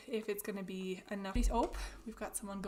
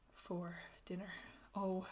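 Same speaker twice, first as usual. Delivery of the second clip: ordinary delivery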